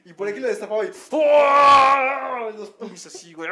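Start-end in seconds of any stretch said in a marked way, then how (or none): 0.1-2.5 loud, high, drawn-out yell; hammed-up screaming cyclist; mock scream. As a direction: belt out a howl imitating him. Imitating the bike guy's scream.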